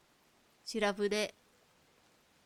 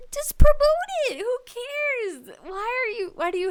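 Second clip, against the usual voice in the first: much higher, louder cry; exasperated outburst; 12 semitones higher, 7 dB louder than usual. Real, not acted. To the companies, a high exasperated outburst. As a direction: throw up your hands and yell it.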